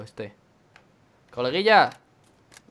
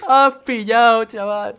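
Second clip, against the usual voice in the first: teasing; 8 semitones higher, 10 dB louder, much slower than usual. Real, not acted. Teasing friend after buy.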